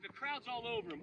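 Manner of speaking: normal talking voice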